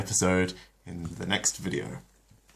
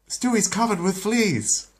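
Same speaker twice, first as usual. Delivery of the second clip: much higher, slow, alarmed; alarmed Lois; 13 semitones higher, 4 dB louder than usual. Acted. Lois tells her husband about the fleas, high and alarmed. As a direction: spell out the emergency.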